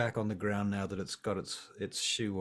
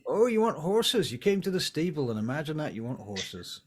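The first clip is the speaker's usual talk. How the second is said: loud, higher sarcastic jab at the party; mock them for asking the obvious; sarcastic stablehand; 5 semitones higher, 4 dB louder than usual.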